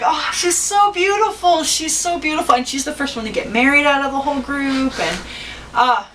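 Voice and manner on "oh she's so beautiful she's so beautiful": mock gushing. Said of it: "it's like".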